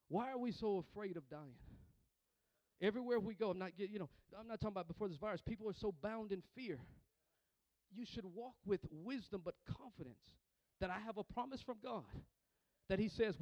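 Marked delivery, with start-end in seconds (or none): none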